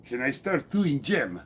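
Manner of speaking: slow, hammed-up Eastern European accent; playful Eastern European accent reading a chat question; mock Eastern European accent. Shown as a demonstration. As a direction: put on a jokey Eastern European accent for "gym". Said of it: "I'm gonna say that with an accent".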